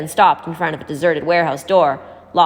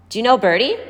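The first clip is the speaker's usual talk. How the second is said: ordinary delivery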